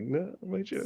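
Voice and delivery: high, soft pretend whimper; mock crying. Writer mourning a cut joke, mock-crying. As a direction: whine it as if tearfully giving up. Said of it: "like, just crying".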